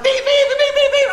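very high, loud beeps; piercing comic "beep beep"; imitated horn. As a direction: squeal out excited motorcycle honks. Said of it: "And I said".

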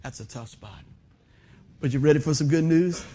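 ordinary talk, nothing marked

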